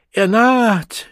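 high, loud ecstatic yell; screamed announcement. A narrator's reading. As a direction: scream the news to the whole circle. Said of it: "schrie Saroyini Engels".

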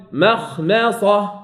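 مخمصة letter by letter, very slow; slow clear reading. Shown as a demonstration. Teacher models مخمصة slowly for students, careful. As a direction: give each letter of مخمصة its time. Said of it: "حرف، حرف".

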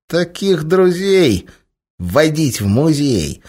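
slow, high grumbling cat; a cantankerous cat objecting; grumpy cat. A narrator's reading. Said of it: "сварливо не согласился кот".

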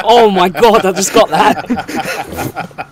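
high laughing exclamation. Real, not acted. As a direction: burst out laughing as you speak. Bursts laughing at joke.

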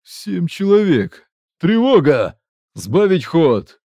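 loud, high, slow battle command; barked orders. Acted. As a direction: yell orders over gunfire.

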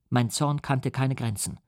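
usual speaking voice

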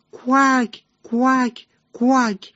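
high mock quacking call from far away; lightly voiced duck cry. A narrator's reading. Mother duck calling from afar.

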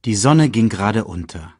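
ordinary delivery, nothing marked

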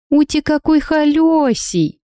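put-on high, slow cooing baby talk. A narrator's reading. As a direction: coo at it like a pet, high-pitched. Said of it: "Сюсюкаю я высоким голосом".

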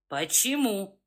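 deep, rumbling menace; low rumbling voice. Acted. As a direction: growl it low like a pack leader. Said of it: "произнёс Тихомир низким, ракочущим голосом".

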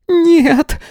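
very high-pitched cry of protest; acted scream. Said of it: "закричала Лариса".

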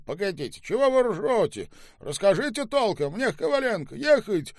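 loud, high-pitched exasperation; impatient demand. Acted. Boss demands that laughing companions explain properly, exasperated. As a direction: cut in, demanding a straight answer.

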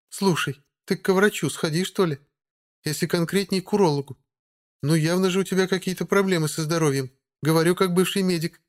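high, needling vampire lady; mocking female voice. A narrator's reading. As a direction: tease her with fake concern. Margo mocking the witch.

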